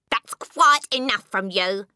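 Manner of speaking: loud, high, slow telling-off; stern rebuke. A narrator's reading. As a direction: silence your cheeky daughter indignantly. Embarrassed mother silencing daughter.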